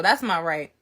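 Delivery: plain reading voice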